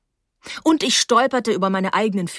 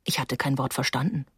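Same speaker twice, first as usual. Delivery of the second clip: plain reading voice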